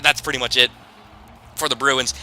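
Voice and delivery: normal talking voice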